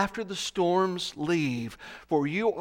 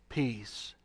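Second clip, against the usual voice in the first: whispered, soft and calming; hushed whisper; 6 semitones lower, 6 dB quieter, slower than usual. Acted. Jesus whispering to the fearful heart to hush the storm. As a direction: breathe the words to still a frightened heart. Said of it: "rebuking the storm, saying to your fearful heart".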